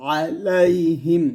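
আলাইহিম drawn out very slowly, each syllable held; slow Quranic chant of আলাইহিম; slow recitation. Shown as a demonstration. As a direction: stretch out আলাইহিম like tajwid recitation. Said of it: "recites Quran word slowly".

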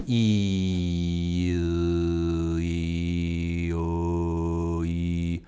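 E, o held as one low droning tone; didgeridoo vowel drone. Shown as a demonstration. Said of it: "by just moving my tongue back".

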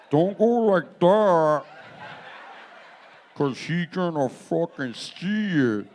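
stretched, dragged words like a slowed tape; slow-motion replay. Shown as a demonstration. Replaying the girlfriend joke for the audience in slow motion. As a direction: play it back slowly, word by word. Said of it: "want me to do it slower?".

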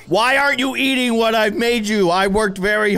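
high, loud, hammed-up indignation; exaggerated exasperated outburst; mock outrage. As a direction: play the wounded chef scolding a diner. Acts hurt chef scolding customer.

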